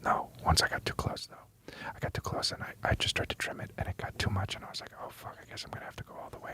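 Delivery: hushed mock ASMR; ASMR whisper. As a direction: close whispered question into the mic. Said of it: "Can you do some A S M R".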